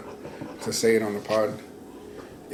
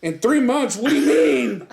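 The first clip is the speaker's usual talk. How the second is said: much higher, rising disbelief; mock shocked friend; 10 semitones higher, slower than usual. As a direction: sputter in alarmed confusion. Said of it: "And then he was like".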